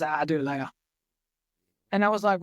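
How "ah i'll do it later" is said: lazy inner voice. Retold or imitated. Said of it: "the other voice goes".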